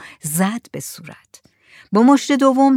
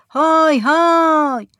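high, long drawn-out holler; answering call; 8 semitones higher, 4 dB louder, much slower than usual. A narrator's reading. Fisherman answering from out at sea, drawn-out shout. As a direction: call back from a distance, stretching each word. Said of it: "صدای محمد احمد علی آمد که جواب داد".

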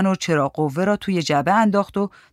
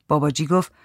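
ordinary delivery

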